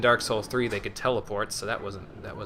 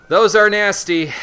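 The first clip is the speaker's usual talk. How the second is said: disgusted emphasis; 8 semitones higher, 11 dB louder, slower than usual. Real, not acted. Reacting to bladed dogs.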